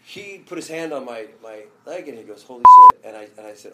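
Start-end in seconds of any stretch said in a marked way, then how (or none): 2.4-3.1 explosive, very high shocked cry; a huge shocked yelp at a sudden arrival; stunned exclamation. Retold or imitated. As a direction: blurt it out in disbelief. Retold shock at Maradona arriving.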